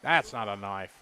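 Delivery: low, soft Australian drawl, lightly done; Crocodile Dundee impression. Host quoting the knife scene, low mock Australian accent. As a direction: quote the knife line in a low Aussie voice. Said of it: "Crocodile Dundee style".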